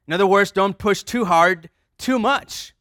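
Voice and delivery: loud, high, driving sermon voice; forceful preaching. Acted. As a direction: preach it out to the whole room.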